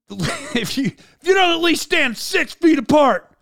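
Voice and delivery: high, emphatic mock tirade; imitated yelling. Star warning crew to stand apart, mock yelling. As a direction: build it up like a star berating the crew. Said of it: "he's yelling at 'em".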